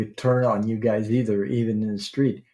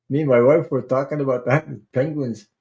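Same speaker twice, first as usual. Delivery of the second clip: ordinary talk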